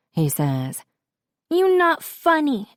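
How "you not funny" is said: put-on very high indignant toddler; little Rose. A narrator's reading. Sick daughter scolds the stranger, toddler voice. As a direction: retort in a squeaky child's voice. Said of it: "Rose says".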